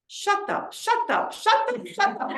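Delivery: put-on high, repeated rebuke in an Israeli accent. Good friend cutting off her upset, high Israeli-accented rebuke. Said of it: "With Israeli accent, you can say".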